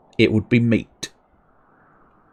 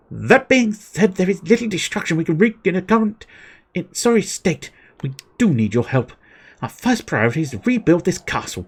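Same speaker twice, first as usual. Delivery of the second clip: raised pitch, steady narrated orders; lightly voiced commander; 7 semitones higher than usual. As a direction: read the general's orders aloud. Reading quest giver's dialogue.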